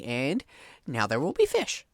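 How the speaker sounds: ordinary talk, nothing marked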